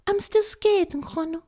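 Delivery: put-on very high frightened little girl; scared child. Girl telling grandmother she is still scared.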